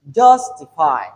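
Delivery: "justify" with JUS punched loud; stress on first syllable. Shown as a demonstration. Teacher models word stress for learners, stressed first syllable. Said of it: "The stress is on the first syllable".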